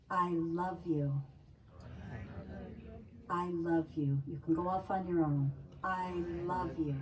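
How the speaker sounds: leading a calm loving affirmation; gentle affirmations. Shown as a demonstration. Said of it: "a state of reception here".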